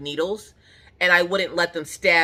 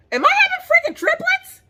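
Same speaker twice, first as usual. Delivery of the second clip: very high, loud shriek of disbelief; hammed-up high-pitched yell; loud freak-out; 6 dB louder than usual. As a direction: shriek it like panicked, dramatic news. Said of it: "you is loud".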